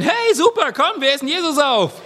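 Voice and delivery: high, ironic fake enthusiasm; hammed-up crowd cheer; mock cheering crowd. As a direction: cheer it like a crowd that never would. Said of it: "dass die Leute jetzt nicht schreien werden".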